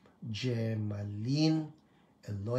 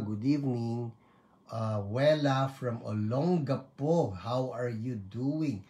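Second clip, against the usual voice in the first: ordinary delivery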